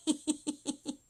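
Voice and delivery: high, giggly burst of laughter; amused high laugh; real laugh. Real, not acted. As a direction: crack up at your own question. Laughs at unicorn remark.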